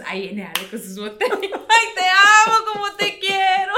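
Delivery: high, laughing fan voice; mock adoring. Imitating friends' gushing over Ale, high and laughing. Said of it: "y todos".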